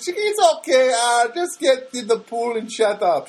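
very high mock Filipino accent; high-pitched imitation of a Filipino therapist; Anna, bad Filipino accent. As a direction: brush him off in a hammed-up Filipino accent. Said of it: "terrible Filipino accent".

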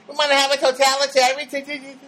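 high, zany comic voice held on one pitch; Jerry Lewis. Acted. Comedian playing with a long word, Jerry Lewis impression. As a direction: stumble through it as a goofy comedian. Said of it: "that's like a Jerry Lewis word".

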